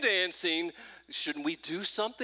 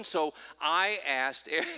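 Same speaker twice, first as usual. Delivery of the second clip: ordinary talk, nothing marked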